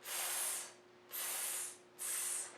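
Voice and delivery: whispered "tss" hisses; monkey tss. Acted. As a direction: hiss "tss" like teasing monkeys. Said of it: "they say to him".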